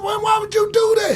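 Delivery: mock indignant shout. Retelling a strip-club story, indignant high shout. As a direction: act out his own indignant protest. Quoting himself in retold scene.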